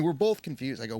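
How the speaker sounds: ordinary talk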